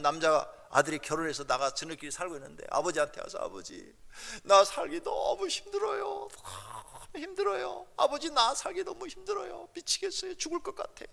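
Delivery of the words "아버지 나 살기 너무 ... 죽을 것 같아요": high, drawn-out mock sobbing; tearful pleading. Grown child crying to father about hard life, tearful pleading. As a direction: act out a wailing plea for help. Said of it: "막 울면은".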